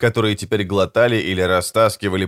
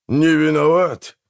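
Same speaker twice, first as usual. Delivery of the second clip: slow, higher indignant question; indignant echo; 6 semitones higher, slower than usual. A narrator's reading. Brother rejects the excuse of shared innocence, indignant. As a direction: echo не виноват back slowly as an incredulous question.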